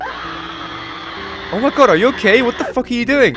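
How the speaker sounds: panicked yelling, high and loud; panicked shouting. Acted. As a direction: freak out at the voice. Intro skit, panicking at voice.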